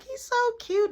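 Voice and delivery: high, sweet gushing; cooing girl. Retold or imitated. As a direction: coo over him like a puppy. Girls cooing over Marky.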